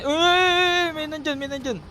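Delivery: sudden high alarmed yelp; startled alarm. Real, not acted. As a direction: jolt up in fright. Someone spotted nearby.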